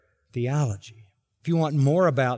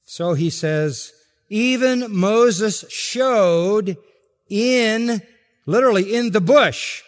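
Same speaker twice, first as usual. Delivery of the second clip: loud, higher, slow in spots; full-force preaching; 6 semitones higher, 5 dB louder than usual. Acted. Pastor driving the text home to listeners, loud preaching. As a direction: press the point like a pulpit preacher.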